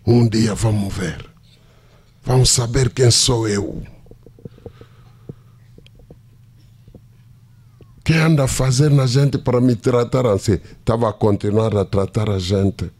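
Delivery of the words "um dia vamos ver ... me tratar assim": nervous anger. Retold or imitated. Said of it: "bem nervoso".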